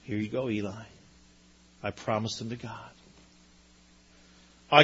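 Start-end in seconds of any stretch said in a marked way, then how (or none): 0.0-2.9 soft, hushed handing over; whisper-soft, letting go of her child; quiet farewell. Pretend. Hannah handing Samuel to Eli, very soft and hushed. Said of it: "imagine that first day".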